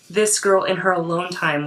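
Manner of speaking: ordinary talk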